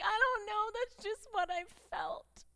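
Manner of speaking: high, tearful voice breaking into sobs; crying. Retold or imitated. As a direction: squeeze the words out through tears. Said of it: "like, cries".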